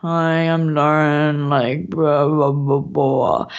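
low, slow monotone drone; mock your own voice as dull and droning; boring voice. Guest sending up her own British voice, boring monotone. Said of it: "your voice just feels so boring".

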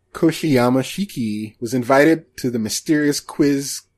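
usual speaking voice

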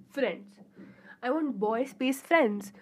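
usual speaking voice

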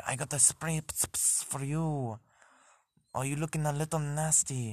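high, lightly French-voiced bidet; a hint of a French helper offering spray. French bidet greeting its user in a light French accent. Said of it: "so they'd be like".

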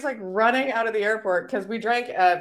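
usual speaking voice

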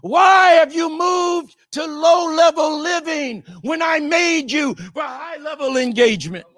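preached divine rebuke; voice of God. Acted. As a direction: call him out like God at the cave. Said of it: "at the cave entrance and says".

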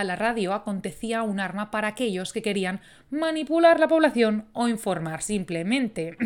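usual speaking voice

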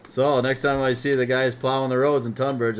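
ordinary delivery

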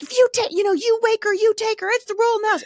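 high, loud frantic mom rant; losing it. Retold or imitated. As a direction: rant at the kids at the top of your pitch. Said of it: "losing my ever-loving mind".

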